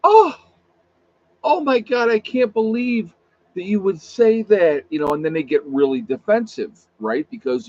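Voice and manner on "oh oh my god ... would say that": high, loud mock-offended gasp and protest; hammed-up wounded disbelief; playing the victim. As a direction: act shocked and wounded, pitched way up. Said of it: "they won't play the victim".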